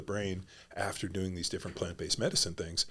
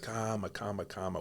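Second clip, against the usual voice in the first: soft Brazilian calma; 6 dB quieter than usual. Retold or imitated. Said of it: "he's always like".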